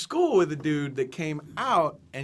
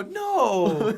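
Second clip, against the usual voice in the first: higher, drawn-out comic "no"; retold long no; 4 semitones higher, 4 dB louder than usual. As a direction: reenact a big, stretched refusal. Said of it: "and I go".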